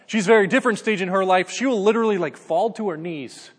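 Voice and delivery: normal talking voice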